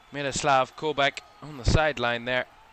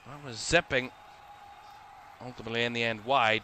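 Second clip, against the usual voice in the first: ordinary talk, nothing marked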